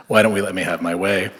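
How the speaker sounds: ordinary talk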